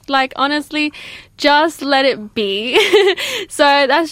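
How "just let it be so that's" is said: slow, giggling; laughing. Real, not acted. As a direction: laugh through it. Laughs through affirmation.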